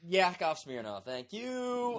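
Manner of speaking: put-on high, soft comic accent sign-off; Yakov Smirnoff. Imitating a comedian's sign-off, high accented voice. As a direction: rise high on the thank you. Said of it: "kinda like, uh, what, uh, Yakov Smirnoff".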